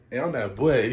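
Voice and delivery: ordinary delivery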